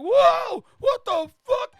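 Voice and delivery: high, loud yell of alarm; startled, high-pitched outburst; panicked shout. Acted. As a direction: shout as if suddenly yanked around. Character yanked around, yells.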